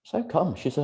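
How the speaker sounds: normal talking voice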